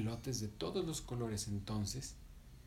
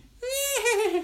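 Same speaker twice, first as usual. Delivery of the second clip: a very high, loud whinnying laugh; the horse rears up and neighs, high loud whinny; 10 dB louder than usual. Acted. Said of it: "hizo así".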